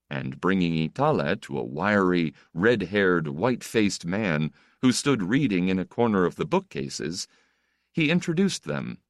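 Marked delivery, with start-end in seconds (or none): none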